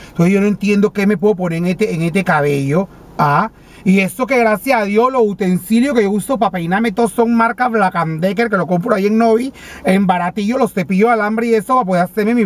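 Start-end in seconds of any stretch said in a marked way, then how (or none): none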